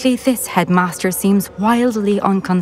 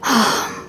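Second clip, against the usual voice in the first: troubled sigh; 4 semitones higher than usual. Acted. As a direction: let out a troubled breath. Reacts to troubling news.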